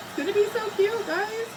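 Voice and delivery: squealing high with delight over the craft; excited gush. Real, not acted. Crafter gushing to her viewers, squealing high with delight. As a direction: gush to the viewers about how cute it is.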